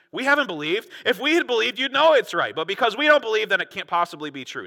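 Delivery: high, loud, self-important insistence easing at the end; condescending mock reasoning. Pharisees explaining why it can't be true, condescending. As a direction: lay it out as if only your view counts. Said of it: "that's the attitude that they have".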